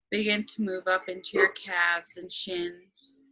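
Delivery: slow, calm meditation voice; guided meditation. Acted.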